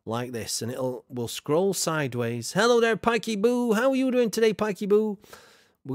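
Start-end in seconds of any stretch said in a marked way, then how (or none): 2.5-5.2 cheery greeting. Real, not acted. Greeting a chat viewer warmly.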